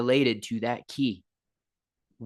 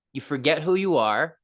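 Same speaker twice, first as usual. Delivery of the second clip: usual speaking voice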